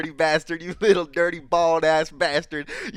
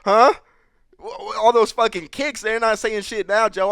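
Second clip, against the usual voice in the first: loud, higher, joking insults; mock trash-talk; 5 semitones higher, 6 dB louder than usual. Coach loudly ribbing Joe about his kicks, in mock anger. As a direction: rail at him for fun.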